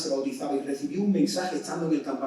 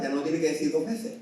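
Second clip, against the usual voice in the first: ordinary delivery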